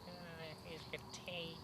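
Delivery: put-on frail old granny offering tea, slowly; shaky old woman. Old woman serving the traveller tea, frail and slow. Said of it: "she's all shaky and old".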